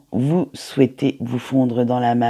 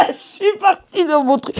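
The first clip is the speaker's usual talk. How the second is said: laughing through the words, much higher; laughing at herself; 12 semitones higher than usual. Real, not acted. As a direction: giggle it out at your own slip. Laughs at own slip.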